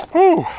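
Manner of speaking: high, breathless blow of relief; relieved whew. Real, not acted. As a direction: puff out relief after the run. After running, fish released.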